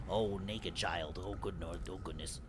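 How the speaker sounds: ordinary talk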